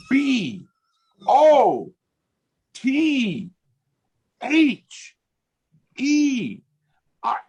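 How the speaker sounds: high, drawn-out letters, one by one; each letter stretched high with a pause after it; imitated spelling out. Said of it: "She's spelling bothered".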